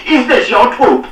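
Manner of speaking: high, loud, slow bellow of rage; bawling. A narrator's reading. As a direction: bellow his name across the yard. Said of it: "he bawled".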